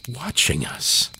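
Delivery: ordinary delivery, nothing marked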